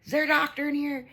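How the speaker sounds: high-pitched call to the room. Retold or imitated. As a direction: pitch it way up, asking everyone. Acts out calling to a cafe room.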